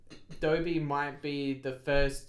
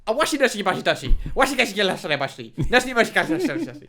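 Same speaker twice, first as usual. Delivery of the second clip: loud, fast gibberish mock Chinese; fake Chinese; 5 semitones higher, 9 dB louder, much faster than usual. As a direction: rattle off fake Chinese syllables, loudly. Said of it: "this is my impression of the fake Chinese".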